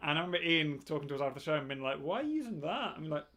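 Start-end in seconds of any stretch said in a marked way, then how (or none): 2.0-3.0 bad Scottish accent, pitched up; a clumsy imitation of a Scottish friend; terrible Scottish accent. Mocking a Scottish friend's question in a poor Scottish accent. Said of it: "That was a terrible Scottish accent".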